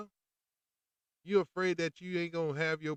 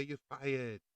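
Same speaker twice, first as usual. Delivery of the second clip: Trump impression. Shown as a demonstration. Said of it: "Do your Trump impersonation and say".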